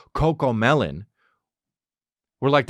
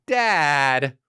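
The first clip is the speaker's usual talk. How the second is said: higher, drawn-out mock kid voice; hammed-up kid calling out a parent; exasperated commercial kid; 4 semitones higher than usual. Kid scolds dad in a commercial, exasperated mock kid voice. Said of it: "And the kid's like".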